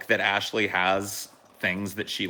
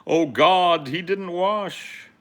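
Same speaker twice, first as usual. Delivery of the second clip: disgusted mock voice; 11 semitones higher, 8 dB louder, much slower than usual. Reading a disgusted comment.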